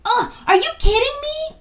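very high, loud dismayed cry; scared girl protesting at the forest setting. A narrator's reading. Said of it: "cried Equia".

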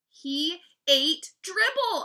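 high, loud, slow insistence; insistent. A narrator's reading. Brother tries to convince disbelieving Mom, insistent. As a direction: punch out each word slowly. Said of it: "I insisted".

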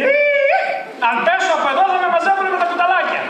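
high, alarmed inner voice; mock panic. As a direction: squeal the fear of falling. Φωνάζει τις σκέψεις του φοβισμένου. (ψεύτικος πανικός, ψιλή φωνή, ο φόβος του ότι θα πέσει)

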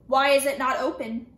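loud, forceful demand; officer demands the coffin be opened, loud. A narrator's reading. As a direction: push the question hard at her. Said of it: "he demanded".

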